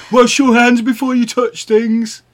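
high, loud mocking nag; mocking high nagging voice. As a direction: mimic a bossy partner loudly. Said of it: "You were like".

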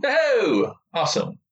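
high, excited "oh"; delighted. Real, not acted. Co-host hears wrestling was drawn, delighted. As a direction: let it leap up with delight.

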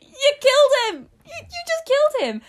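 high, loud, hammed-up exclamation; mock shocked outcry. Acts out shocked reaction to laughter.

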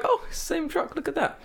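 high sarcastic sing-song; sarcastic squeal at the same truck; mock surprise. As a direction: chirp it up like a fake discovery. Spots the same truck again.

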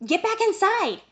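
high urgent command; urgent shout. Acted. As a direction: hurry him in, high and sharp. Urging friend to hide.

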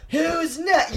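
high-pitched, rowdy mock yell; half-volume party holler; retold yell. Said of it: "I was literally yelling out".

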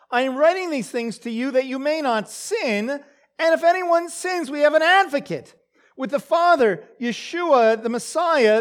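fervent scripture reading. Acted. Preacher reading First John to his congregation, rising to a fervent proclamation.

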